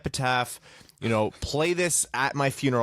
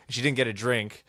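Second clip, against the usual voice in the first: normal talking voice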